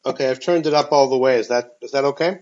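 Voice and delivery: usual speaking voice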